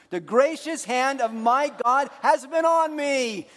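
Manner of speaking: slow, high, loud firm proclamation; with conviction. Shown as a demonstration. Pastor leading the congregation to say it with conviction, slow and firm. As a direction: declare it like it really happened. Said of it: "one more time with conviction".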